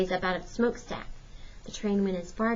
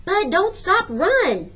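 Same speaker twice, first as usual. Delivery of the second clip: high, loud shout urging him on; frantic scream, pitched way up; 11 semitones higher, 7 dB louder, much slower than usual. A narrator's reading. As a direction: yell from a moving boxcar to keep him running.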